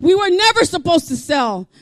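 loud, high, shouted sermon cadence; full-force preaching. Real, not acted. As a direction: cry out a warning to the congregation.

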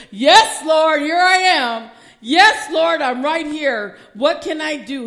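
shouting high, yes Lord here I am; fervent loud answer. Pretend. Disciple answering Jesus's call, loud and fervent. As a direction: cry out ready to serve. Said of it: "Jesus was calling the disciples".

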